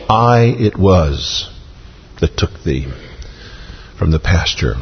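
"I" stretched slowly, the rest dropping low and soft; emphatic, drawn-out "I"; stress on I. Acted. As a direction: hold "I", then let the words fall away. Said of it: "is emphatic".

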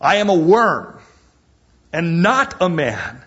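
a touch of Christ's lament; Jesus speaking. Retold or imitated. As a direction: declare it in anguish. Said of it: "Jesus speaking prophetically".

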